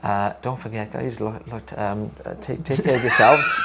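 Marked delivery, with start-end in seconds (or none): 2.2-3.6 breaking up with laughter; laughing through words. Real, not acted. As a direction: giggle while saying it. Giggling through the sign-off.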